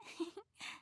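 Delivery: a quiet shy little laugh; hushed bashful chuckle; soft embarrassed giggle. Acted. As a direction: giggle softly, a bit flustered. Embarrassed under his gaze.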